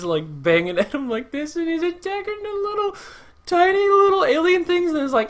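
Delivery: put-on very high, squealing baby-talk voice; cutesy gushing. Gushing to viewers over adorable Baby Groot, cutesy squeal. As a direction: coo in a tiny high-pitched voice.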